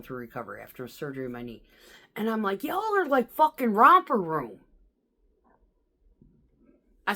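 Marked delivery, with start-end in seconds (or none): 2.6-4.6 loud, slow, exasperated telling-off; exasperated scolding. Retold or imitated. Scolding whiny coworkers on the radio, loud and exasperated. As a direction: let them have it, slow and loud.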